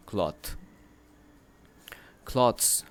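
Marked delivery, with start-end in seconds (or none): 2.2-2.8 clothes said higher and louder; emphasized plural. Shown as a demonstration. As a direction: stress clothes to set it against cloth. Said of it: "اب یہ singular ہے". (جمع پر زور دے کر clothes)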